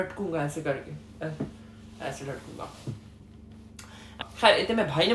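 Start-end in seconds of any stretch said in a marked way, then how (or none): 0.0-2.7 faint limp voice. Pretend.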